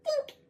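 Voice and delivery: high, light bonk sound; a quick high plink; cartoon dink. Stick bonking the fox's head, high playful dink.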